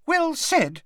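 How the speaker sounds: tiny high squeak; squeaky voice. Acted. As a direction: squeak like a little wizard. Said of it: "squeaked Professor Flitwick".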